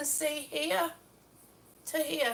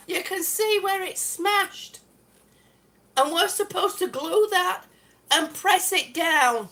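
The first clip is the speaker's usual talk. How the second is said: usual speaking voice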